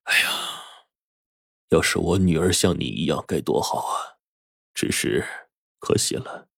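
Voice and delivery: soft, halting lament of a pained father; sighing regret. A narrator's reading. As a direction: sigh it out with heavy regret, trailing quiet. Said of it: "翁世煌叹了口气说".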